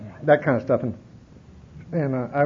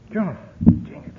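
breathy muttered curse, slow; frustrated mutter; 5 semitones higher than usual. Retold or imitated. Driving to town, frustrated, muttering to himself. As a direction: grumble it under your breath.

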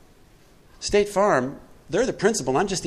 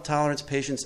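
ordinary talk, nothing marked

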